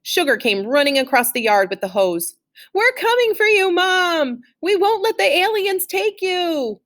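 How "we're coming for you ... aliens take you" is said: high, loud rescue charge; frantic battle cry. A narrator's reading. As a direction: storm in to save Mom. Chick charges with hose.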